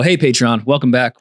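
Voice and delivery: normal talking voice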